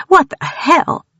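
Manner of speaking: high, incredulous cry. A narrator's reading. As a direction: gasp it in disbelief.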